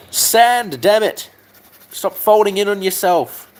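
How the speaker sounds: high-pitched exasperated character; mock scolding. Mock-scolding the sanding job.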